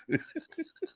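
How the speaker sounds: high, bursting laugh; burst of laughter. Real, not acted. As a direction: laugh out at your own joke. Laughs at his own Tottenham jab.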